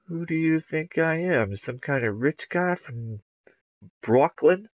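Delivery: put-on Brooklyn accent, indignant question. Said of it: "That's my Brooklyn accent".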